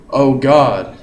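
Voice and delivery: "Oh God" both words hit hard and loud; spondaic stress. Shown as a demonstration. Teacher shows a spondee with a loud exclamation. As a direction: punch both syllables equally. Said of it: "two syllables next to each other with equal stress".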